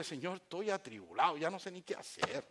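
soft, plain, distressed confession; sincere troubled prayer. Shown as a demonstration. Troubled believer talking honestly to the Lord, sincere and soft. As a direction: confess quietly that you are at a loss. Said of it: "Sincero, con emoción".

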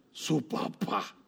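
slow, soft whispered reveal; dramatic whisper. Acted. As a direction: whisper it like a surprise twist. Revelación dramática del padre. (susurro dramático y lento)

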